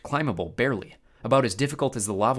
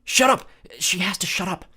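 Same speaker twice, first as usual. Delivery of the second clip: urgent hissed mutter; 8 semitones higher, 6 dB louder than usual. Acted. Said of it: "Annabeth muttered".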